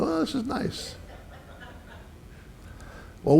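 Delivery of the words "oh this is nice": high, soft, laughing "Oh, this is nice"; mock dreamy delight. Pastor mocking a calm canoe ride, high laughing coo. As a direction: coo it like lazing on a lake.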